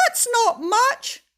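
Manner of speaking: high, loud, slow; wailing protest. Acted. Mister Tickle protests the rule, high and loud. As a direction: complain it isn't fair.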